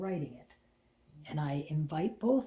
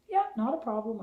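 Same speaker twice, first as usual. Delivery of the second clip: chipper, louder, higher-pitched fake cheer; bright and sunshiny; 6 semitones higher, 7 dB louder than usual. Cheerfully answering the director on the intercom. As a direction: sound breezy for the boss. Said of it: "all bright and sunshiny".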